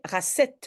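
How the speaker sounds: "racette" said with an S, ra-SETTE; wrong pronunciation. Shown as a demonstration. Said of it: "je dois prononcer racette".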